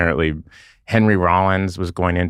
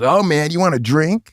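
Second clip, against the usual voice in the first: higher, sing-song party offer; 9 semitones higher than usual. Retold or imitated. Rock singer offering a straight-edge fan a drink, higher-pitched party-guy voice.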